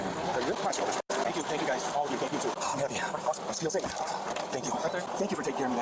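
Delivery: earnest thanks. Real, not acted. Suspect thanking officers repeatedly.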